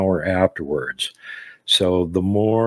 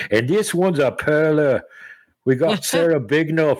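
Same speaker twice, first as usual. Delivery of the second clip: attempted Australian accent; 7 semitones higher than usual. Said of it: "That accent needs work".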